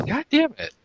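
high exasperated cry; frustrated curse. Retold or imitated. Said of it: "I was just like".

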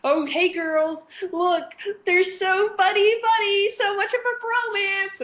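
very high, squeaky gushing voice; mock gushing movie. Imitating movies teasing girl fans, high mocking gush. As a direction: tease the girls in a high singsong. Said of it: "where they're like".

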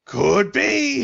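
high, loud jazzy exclamation; [name] voice. Retold or imitated. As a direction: belt it out in a high cartoon voice. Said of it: "how to impersonate [name]".

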